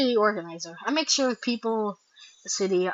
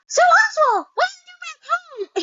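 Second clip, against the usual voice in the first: much higher, bright put-on voice for the other character; high-voiced cartoon friend asking about home; 8 dB louder than usual. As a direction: pitch way up to play the friendly character.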